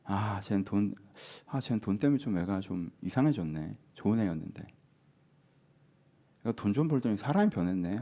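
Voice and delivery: low, soft, pitying murmur; acted pity. Onlooker about a friend changed by money, pitying. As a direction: feel sorry for a changed friend, quietly. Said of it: "안쓰러워야지".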